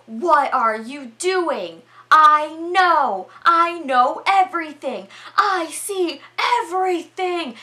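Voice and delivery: loud, high, hammed-up paranoid outburst; ranting accusation. Storyteller voices Howard confronting the captives, paranoid shouting.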